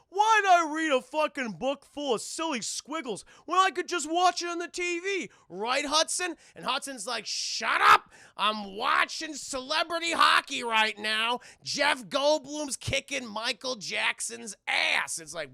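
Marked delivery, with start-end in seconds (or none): 0.1-6.4 high, loud dumb-guy whine; dopey gargoyle voice. Acted. Broadway mocking reading to Hudson, high and loud. 7.3-15.2 put-on loud, slow grumpy old gargoyle; grumpy shout. Hudson yelling at Broadway over hockey, loud and slow.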